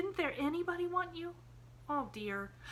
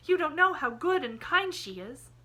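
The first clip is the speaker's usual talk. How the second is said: higher and louder, bursting with the idea; a girl thrilled by her plan; excited cry; 11 semitones higher, 9 dB louder than usual. A narrator's reading. Pollyanna crying out to Jimmy that Aunt Polly will take him, higher and louder. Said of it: "she cried".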